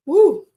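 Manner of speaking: short, high, loud whoop; startled woo. Real, not acted. Host reacting to a card flipping out, startled woo. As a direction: let out a quick high woo.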